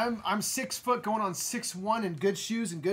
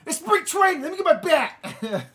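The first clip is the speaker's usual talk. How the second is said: loud Boston bluster, slowing on the bat line; hammed-up Boston tough guy; Boston accent, hammed up; mock Boston threat; 10 semitones higher, 9 dB louder than usual. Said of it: "how to do Boston".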